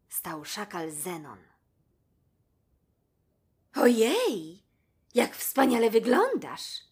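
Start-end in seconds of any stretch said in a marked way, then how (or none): 3.8-6.8 higher, louder cry of admiration; delighted exclamation. A narrator's reading. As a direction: gasp with joy at the jackal's tailcoat. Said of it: "wykrzyknęła Bibi". (radosny okrzyk zachwytu, wyżej i głośniej)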